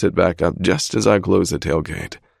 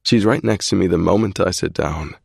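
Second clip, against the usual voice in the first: plain reading voice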